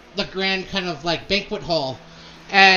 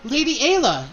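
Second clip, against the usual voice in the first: sweet social flutter, pitched up; Lady Dahlia; 5 semitones higher than usual. Acted. GM voices Dahlia introducing her new friend, high gushing.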